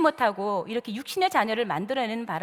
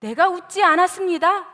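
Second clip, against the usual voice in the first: loud, higher, emphatic denial; firm denial; 5 semitones higher, 5 dB louder than usual. Acted. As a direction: deny it absolutely, acting the accused. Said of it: "이렇게 단호하게". (단호하게 딱 잡아떼듯)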